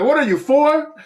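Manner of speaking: high, loud mock yell at kids; replaying a yell at bread-feeding kids; retold yell. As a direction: yell it like scolding a toddler. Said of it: "I just yelled at them".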